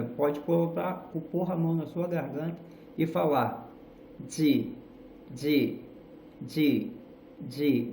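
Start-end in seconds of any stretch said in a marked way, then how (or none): 4.3-7.9 repeated "de" with throat vibration; voiced de. Shown as a demonstration. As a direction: feel the buzz on each "de". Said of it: "Você sente a vibração na garganta".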